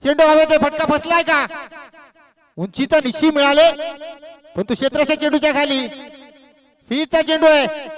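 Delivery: lifted pitch, punchy live call; excited ball-in-the-air call. Real, not acted. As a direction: follow the high ball with mounting suspense.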